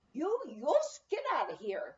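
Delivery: put-on a small Norwegian mother ordering the men out, in her accent; strong Norwegian accent. A narrator's reading. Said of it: "in her strong Norwegian accent".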